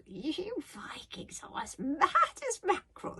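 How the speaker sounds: high, drawling dragon voice; chuckling little dragon. Acted. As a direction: tease slowly in a high creature voice. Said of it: "Toothless chuckled".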